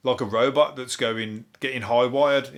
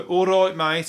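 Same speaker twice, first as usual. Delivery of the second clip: high, loud put-on Birmingham greeting; imitated Brummie voice; Brummie accent; 6 semitones higher, 6 dB louder, slower than usual. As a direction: greet a mate in broad Brummie. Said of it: "for the Brummies".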